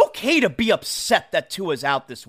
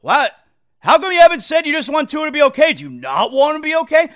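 fast, louder mock quiz from friends, pitched up; quizzing friends; 5 semitones higher, 4 dB louder, faster than usual. Friend grilling a fan who posted nothing, in fast mock outrage. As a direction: fire it off like a nosy friend. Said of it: "your friends are gonna be like".

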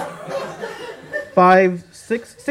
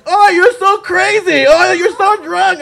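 high, loud mock-teasing crowd voice; a crowd of friends squealing at her; 8 dB louder than usual. Friends teasing her at the party, high and loud. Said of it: "They think I'm".

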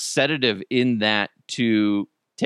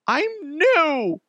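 high, sighing excuse; sheepish new guy. Pretend.

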